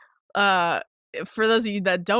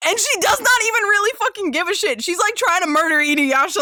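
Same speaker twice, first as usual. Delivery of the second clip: much higher, louder incredulous rant; furious disbelief at the hero; really angry; 6 semitones higher, 3 dB louder than usual. Real, not acted. Podcaster asking Inuyasha how he's okay with it, angry. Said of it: "I'm getting really angry".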